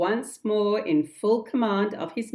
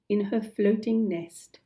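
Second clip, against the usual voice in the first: usual speaking voice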